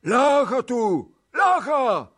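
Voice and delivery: high, slow, loud calling; crowd's shout. A narrator's reading. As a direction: holler it out as an angry assembly. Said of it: "wolali kurzeniowi". (głośne nawoływanie tłumu)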